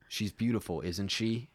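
plain reading voice

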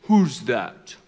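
haughty judge. Acted. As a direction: look down on the petitioner, low. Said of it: "The judge would look down from his big chair".

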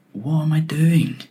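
high, drawn-out mock despair; incredulous self-questioning. Worker fed up moving digital bits, acted incredulous wail. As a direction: stretch the question out in acted bewilderment. Retold despair at pointless work.

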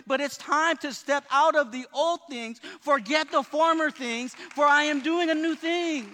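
loud, rousing sermon climax; preaching at full force. Acted. Pastor urging his church to get unstuck, preaching loud. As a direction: drive it home to the congregation.

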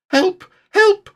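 very high, loud squeaky help; a tiny voice crying out; high squeaky cries. A narrator's reading. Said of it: "my voice has gone all squeaky".

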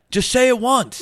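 high-pitched imitation of a crowd heckler; heckler's yell. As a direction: yell it out like someone in the audience. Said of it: "somebody yells out".

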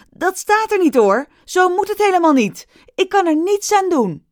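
high, loud frantic shout. Acted. Riki shouts to the audience in frantic protest. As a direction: cry out to the whole hall. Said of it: "Ze riep naar de zaal".

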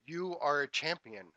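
storyteller's contained shout; mock scream. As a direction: proclaim it out to the mountain. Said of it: "the man screams again and says".

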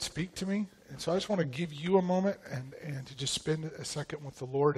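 ordinary delivery

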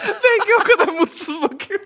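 high burst of laughter; laughing. Real, not acted. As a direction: laugh while speaking. Laughing at Bengi's face.

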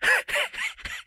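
bad smoker's laugh, cartoon dog. Shown as a demonstration. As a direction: do Muttley's rasping cartoon snicker. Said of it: "Let's see if I can do it".

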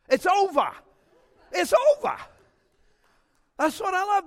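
"It's over" proclaimed high and loud, with a falling finish; excited proclamation. Real, not acted. Preacher rousing the congregation, with an excited high loud proclamation. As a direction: shout it out like a triumphant preacher.

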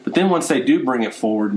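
ordinary talk

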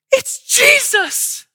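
very high, slow, awestruck; awed shepherds. Retold or imitated. Shepherds marvelling at the baby, high slow awe. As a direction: stretch it out in wonder. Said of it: "the shepherds are like".

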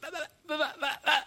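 imitating his stutter. Boy stuck stuttering in class, mock stutter. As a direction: act out a kid stuck on the first sound. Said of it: "And I'd be".

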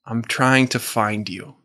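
normal talking voice

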